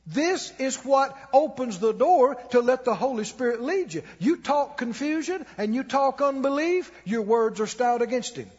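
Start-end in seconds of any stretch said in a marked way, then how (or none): none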